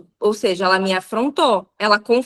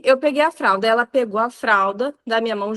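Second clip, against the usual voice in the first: usual speaking voice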